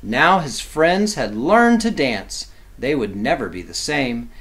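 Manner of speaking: lively, sing-song picture-book reading; reading to children. A narrator's reading. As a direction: read it like bedtime rhyme for kids.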